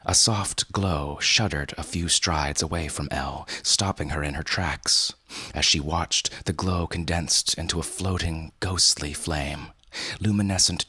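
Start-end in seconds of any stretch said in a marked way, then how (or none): none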